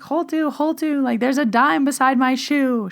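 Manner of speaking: high-pitched call across the house, half yelled; calling out to aunt. Retold or imitated. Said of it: "I started yelling to my aunt".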